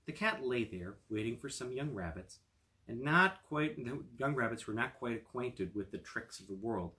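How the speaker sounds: usual speaking voice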